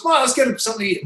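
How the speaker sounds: high, quick coaxing voice; eager cousin. Retold or imitated. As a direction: imitate a cousin pushing for food. Cousin urging him to eat.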